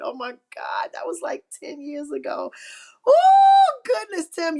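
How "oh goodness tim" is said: a high, loud burst of delight; real joyful shout of surprise; surprised shriek. Real, not acted. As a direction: shriek it, overwhelmed with joy. Reacting to the viewer's memory.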